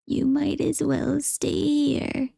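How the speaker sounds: put-on slow old woman voice; grudging old woman, slowly.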